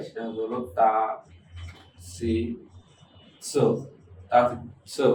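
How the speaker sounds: তা, সি, সো each said separately, like a teacher drilling them; careful classroom pronunciation; sounding out letters. Shown as a demonstration. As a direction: drill the syllables one at a time. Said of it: "উচ্চারণ একটু ভিন্ন হবে".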